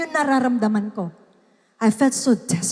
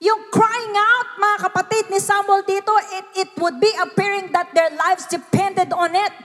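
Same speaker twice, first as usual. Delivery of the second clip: raised, high-pitched preacher's voice; full-force preaching; 6 semitones higher than usual. Real, not acted. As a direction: press it home with rising urgency. (malakas at madamdaming pangangaral)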